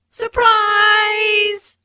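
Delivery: high, loud, drawn-out party cheer; surprise shout. Acted. Guests greeting Aunt Mary at her party, surprise shout. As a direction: call it out high and long.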